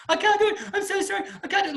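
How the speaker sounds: high, whimpering wail; mock panic. Instructor mimicking the student, mock panicked wail. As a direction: imitate a hysterical student. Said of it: "And she loses it".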